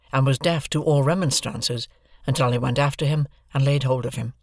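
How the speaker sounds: usual speaking voice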